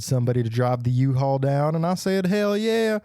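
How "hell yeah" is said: normal talking voice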